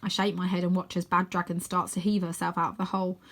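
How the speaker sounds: plain reading voice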